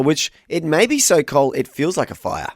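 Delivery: normal talking voice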